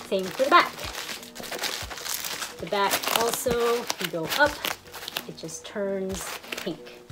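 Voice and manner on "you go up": usual speaking voice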